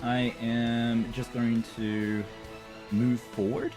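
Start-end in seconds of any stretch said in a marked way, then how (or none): none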